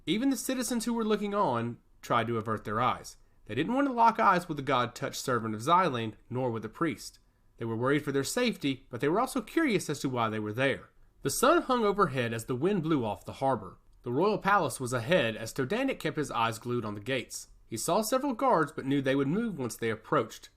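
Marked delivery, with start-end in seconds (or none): none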